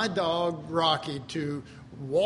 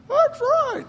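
very high, louder squeaky dog voice, a comic cartoon dog; dog Rocky talking; 6 dB louder than usual. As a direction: play the dog chiming in with an amen in a squeaky voice. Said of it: "way in the background Rocky going".

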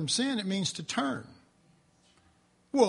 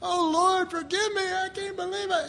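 mock pleading; 10 semitones higher than usual. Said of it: "and I'd go".

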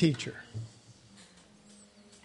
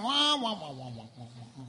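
imitated unintelligible adult wah-wah; Charlie Brown parents' wah-wah; 7 semitones lower, 5 dB quieter than usual. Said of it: "Charlie Brown's pants".

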